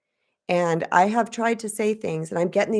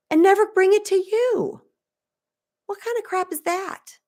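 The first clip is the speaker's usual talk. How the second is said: high-pitched indignant cry; indignant outburst; 12 semitones higher, 4 dB louder than usual. Real, not acted. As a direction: rise up high in outrage at the injustice. Indignant rant.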